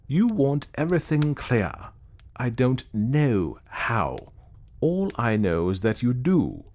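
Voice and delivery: "everything", "how" and "do" weighted heavily; great emphasis on certain words. A narrator's reading. Clayton turning on his friend, great emphasis on certain words. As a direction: lean hard on "how", drawing it out. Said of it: "putting a great emphasis on certain words".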